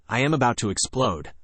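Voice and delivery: plain reading voice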